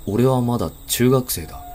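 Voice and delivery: ordinary delivery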